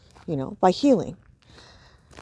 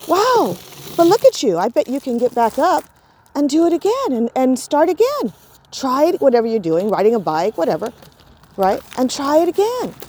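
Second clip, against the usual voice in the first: put-on higher, bright cheering on a fallen child; upbeat encouragement; 6 semitones higher than usual. Shown as a demonstration. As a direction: cheer him to try again. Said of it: "Or you could look at him and say".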